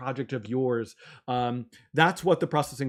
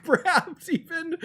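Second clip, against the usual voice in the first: laughing; slower than usual. Real, not acted. Laughing over shock.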